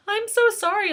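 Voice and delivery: high, anxious apologetic mom; freaking-out mom. Retold or imitated. Teacher mimics a frantic mom apologizing, high and anxious. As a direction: mimic a panicking mother apologizing. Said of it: "Mom was freaking out going".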